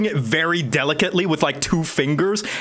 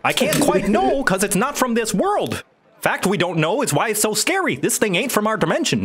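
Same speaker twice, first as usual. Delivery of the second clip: higher-pitched, swooping wizard voice; folksy wizard; 6 semitones higher than usual. Acted. Wizard explains otherworldly thing to party, folksy voice. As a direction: warn them like a spooked yokel.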